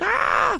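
very high imitated yelp; mock freak-out scream. Dad imitating his boy with ants on him, high mock scream. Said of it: "Just freaking out".

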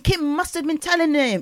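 ordinary talk, nothing marked

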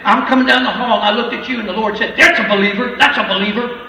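loud ecstatic retelling; fired up. Retold or imitated. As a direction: tell it like a fired-up believer lady. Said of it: "she is fired up".

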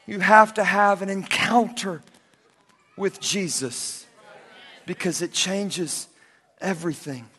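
hushed sincere close. Acted. Preacher quietly tells the congregation they need Jesus, soft and slow. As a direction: bring it down to a hush.

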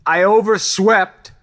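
high, very loud drawn-out wail; screaming. A narrator's reading. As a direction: scream it slowly at full force. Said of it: "Then Tony began screaming".

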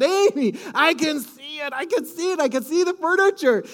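high, eager telling his wife what he sees; bursting with excitement over the new furniture; retold excitement. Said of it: "I was just so excited".

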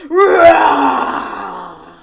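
loud long roar; big roar. Shown as a demonstration. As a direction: roar like a lion. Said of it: "can you do a big roar for me".

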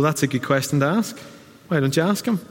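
ordinary talk, nothing marked

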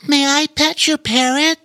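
put-on high small-girl voice; shy little Ivy. A narrator's reading. Shy Ivy asking Pete about the parrot, high small voice. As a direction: ask timidly like a small child.

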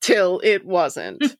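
slow, ominous mystery-show narration; true-crime show narrator. Retold or imitated. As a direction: draw it out like a true-crime teaser. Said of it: "like Forensic Files or like, ... Unsolved Mysteries".

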